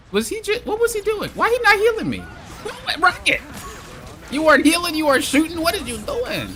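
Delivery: high, strained disbelief with a laugh; incredulous squeak. Real, not acted. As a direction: pitch it up in outrage at the healer. Disbelief at teammate not healing.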